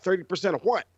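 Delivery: incredulous outburst. Retold or imitated. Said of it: "And I'm like".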